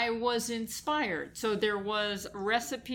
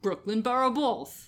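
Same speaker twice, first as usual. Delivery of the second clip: put-on Brooklyn accent; 3 semitones higher, 3 dB louder than usual. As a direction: say it like a born New Yorker. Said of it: "Say it again".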